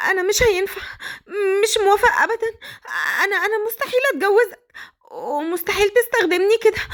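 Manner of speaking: very high, tearful pleading; terrified girl. Acted. Captive girl begging Badr, high and tearful. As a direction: beg him to let her go home.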